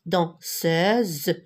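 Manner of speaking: "danseuse" split slowly into dan, seuse; syllable by syllable. Shown as a demonstration. Teacher showing pupils the two vowels, syllable by syllable. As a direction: break "danseuse" in two, drawing out each syllable.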